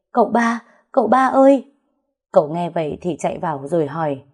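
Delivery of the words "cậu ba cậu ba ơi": high, loud desperate call to Cậu ba; crying out for help. A narrator's reading. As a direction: shout across the tent for him. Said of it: "liền gào lên".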